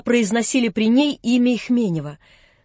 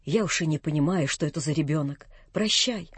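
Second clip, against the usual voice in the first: much lower, much softer, defeated; crushed old man; 7 semitones lower, 7 dB quieter than usual. A narrator's reading. Old man leaving, crushed, quietly to Vanya. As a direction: say it as a broken man. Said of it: "Он был как убитый".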